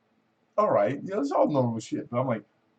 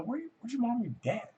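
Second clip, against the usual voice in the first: mock parental challenge; 13 semitones higher, 4 dB quieter, faster than usual. Mock questioning an unsupervised kid.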